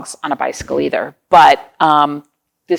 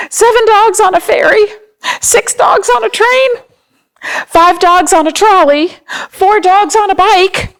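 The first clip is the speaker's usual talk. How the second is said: much higher, louder, racing mock panic with a whimper; hammed-up panic; 10 semitones higher, 6 dB louder than usual. Reading the dogs' escape to children, mock frantic panic. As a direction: play the fleeing dogs as frantic. Dogs flee backwards through book.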